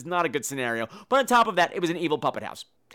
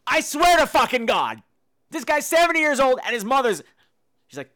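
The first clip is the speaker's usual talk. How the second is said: ordinary delivery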